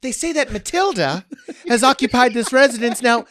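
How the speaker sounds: milk the grim tale for laughs; hammed-up ghost-tour storyteller. Comic mocking Civil War house ghost stories, hammed-up storyteller. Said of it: "their stories are also so wild".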